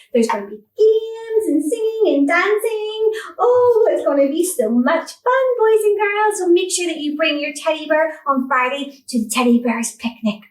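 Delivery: bright, bouncy preschool host; kids show host. Acted. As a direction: sell the picnic to toddlers. Hosts kids' show, upbeat.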